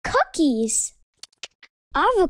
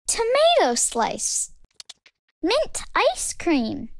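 ordinary delivery

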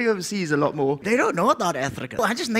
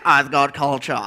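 cultured yogurt voice; 8 semitones lower than usual. Acted. Yogurt explaining itself to milk, low cultured voice. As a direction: answer as self-consciously cultured yogurt, deep. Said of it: "Why do you sound like that?".

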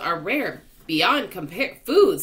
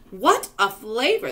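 loud delighted cry; excited cry; 4 semitones higher, 5 dB louder than usual. A narrator's reading. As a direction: shout your delight at the taste. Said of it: "the centipede cried".

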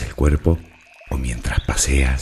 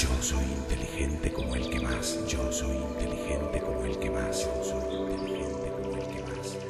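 much softer echo, receding into silence; fading echo; 11 dB quieter than usual. Acted. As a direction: repeat the affirmation like a distant, fading inner voice. Affirmation repeated as fading echo. (eco suave que se desvanece)

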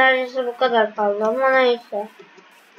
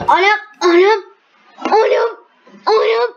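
excited exclaiming; 8 semitones higher, 8 dB louder than usual. Real, not acted.

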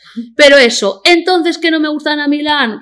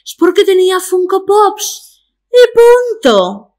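slow, higher put-on voice; Ana Milán impression; 8 semitones higher, slower than usual. Imitating Ana Milán answering a caller on her podcast, slow and higher. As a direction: lecture the caller in a faked sultry voice. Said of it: "qué bien lo hago".

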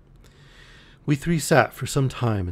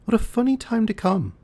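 put-on much higher, bright young girl's voice; girlish exclamation; 13 semitones higher than usual. A narrator's reading. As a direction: squeak it out in amused surprise. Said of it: "exclaimed Cynthia".

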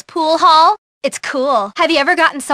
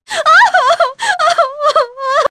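shrill mocking laugh; 11 semitones higher than usual. Mocking laugh after insult.

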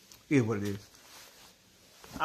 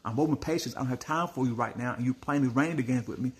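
normal talking voice

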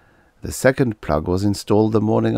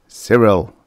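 a louder, stern call of the name; terrible voice; 3 semitones higher, 7 dB louder than usual. A narrator's reading. Officer calling the toddler, loud and stern. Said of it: "cried Officer Comstock in a terrible voice".

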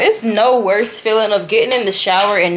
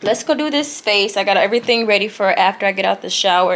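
ordinary talk

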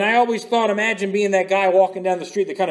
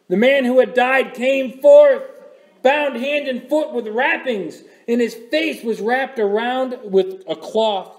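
slow, raised reading of the verse; scripture reading; 3 semitones higher than usual. Acted. Preacher reads the verse to the congregation, slow and raised. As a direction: read it out slowly, with weight.

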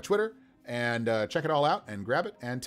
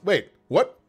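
raised, louder questioning; lightly voiced suspicious paladin; 7 semitones higher, 6 dB louder than usual. Paladin questioning the writer, lightly voiced and suspicious. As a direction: challenge them, pitch and volume up. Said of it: "the paladin goes".

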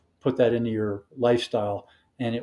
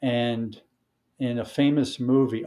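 ordinary talk

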